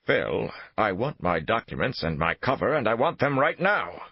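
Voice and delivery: insist, building to anger; demanding. A narrator's reading. Thornhill demands documents.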